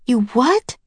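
high, rising disbelief; incredulous. A narrator's reading. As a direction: ask it as if you misheard something absurd. Nancy hears absurd claim.

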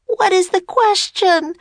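put-on high-pitched child's shout. A narrator's reading. Annie demanding the question from the serpent, shouting. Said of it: "shouted Annie".

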